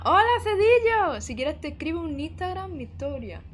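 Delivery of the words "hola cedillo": loud greeting. Real, not acted. Greets a viewer loudly.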